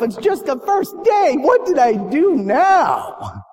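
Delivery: alarmed student, voice shooting up; panicked thought. Retold or imitated. Panicked inner thought, called to office.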